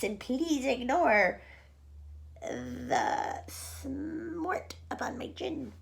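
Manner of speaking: preening put-on voice, slow in places; vain Aunt Spiker voice. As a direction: admire yourself in a sly, drawn-out voice.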